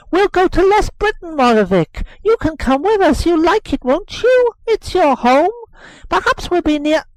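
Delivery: high, loud eager chatter; boy telling nurse they'll go to Brittany; excited boy. Acted. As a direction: babble happily like a hopeful child.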